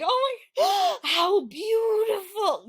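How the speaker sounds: high, slow, loud gushing; fake admiration. Praising an ugly baby to its parent, slow high gush.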